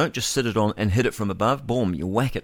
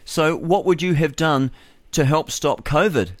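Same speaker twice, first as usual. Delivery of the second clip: higher, louder, slow sneering question; mock the critic's challenge, drawn out; 6 semitones higher, 4 dB louder, slower than usual. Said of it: "Anthony says to me".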